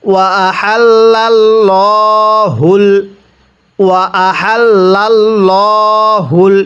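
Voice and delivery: আহাল্লাল্লাহুল with a thick, full আল্লাহ; drawn-out recitation with a heavy আল্লাহ sound; slow tajweed chant; heavy Allah. Shown as a demonstration. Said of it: "আল্লাহ শব্দটিকে মোটা করে পড়ব".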